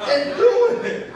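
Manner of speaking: high, loud and slow with a laugh; emphatic challenge. Real, not acted. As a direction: hit it home with a grin. Preacher punches the challenge.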